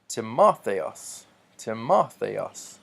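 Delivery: "Timotheos" drawn out slowly, ti-MO-the-os with MO punched; exaggerated stress. Shown as a demonstration. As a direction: hit the MO of "Timotheos" hard and slow. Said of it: "putting so much emphasis".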